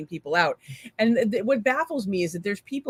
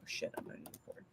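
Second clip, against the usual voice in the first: whispering; 22 dB quieter than usual. Real, not acted. Realises the stream isn't recording.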